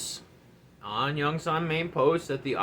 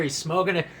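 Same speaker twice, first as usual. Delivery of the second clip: normal talking voice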